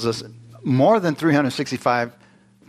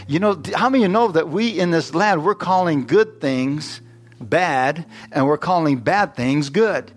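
raised, emphatic pulpit delivery; higher-pitched exhortation to the congregation; impassioned preaching; 4 semitones higher than usual. Real, not acted. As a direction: preach it with urgency, punching key words.

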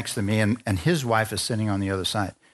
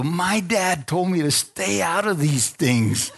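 higher, slow, drawn-out warning; retold wary protest; 5 semitones higher, slower than usual. Wife warns husband about tents, in wary protest. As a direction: imitate a wife digging her heels in, slowly.

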